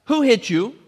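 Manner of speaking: high, loud taunting demand; mocking demand. Guards taunting blindfolded Jesus, loud mocking demand. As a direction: jeer it out, pitched well up. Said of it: "They blindfolded him and demanded".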